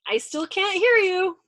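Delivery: high, slow, loud call; innocent calling back. A narrator's reading. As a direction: call slowly, blandly unaware. Navigator calls back, not hearing.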